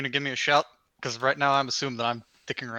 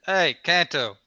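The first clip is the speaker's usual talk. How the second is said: calling out across the lot; hollering; 5 dB louder, much slower than usual. Acted. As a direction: holler his name to get his attention. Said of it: "I'm just hollering at him".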